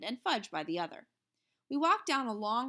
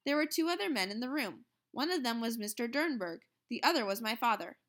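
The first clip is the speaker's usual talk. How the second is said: plain reading voice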